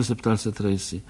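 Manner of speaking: usual speaking voice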